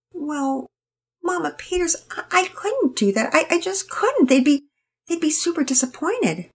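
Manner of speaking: put-on high, eager-to-please girl rushing on; tired young girl. A narrator's reading. Penelope explains herself to Mama Peters, high and earnest. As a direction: plead earnestly, a bit flustered.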